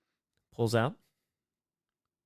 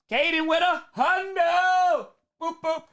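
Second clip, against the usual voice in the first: shrill-high, slow, loud announcer yell; sudden high-pitched shout, stretched out; high yelled callout; 5 dB louder, slower than usual. Acted. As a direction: belt it out like a game show host. Man yells donation callout again.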